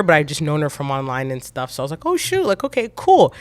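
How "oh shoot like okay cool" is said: high-pitched surprised reaction, retold; pleasant surprise. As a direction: take in an unexpected arrival. Reacting to seeing who walked in.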